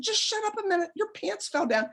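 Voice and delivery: high-pitched, urgent retort; exasperated hushing. Retold or imitated. Daughter to crying mom in the closet, exasperated hushing. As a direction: retell it high and harried, without extra volume.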